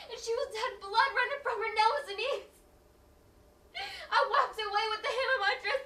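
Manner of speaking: high, loud, distraught storytelling; anguished dramatic narration. Acted. Mother recounts her child's injury, distraught. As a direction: tell it through rising horror, near tears.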